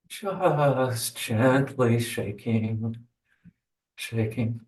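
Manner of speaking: slow, soft guiding voice; guided meditation. Acted. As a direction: ease them into gentle shaking. Guides shaking slowly.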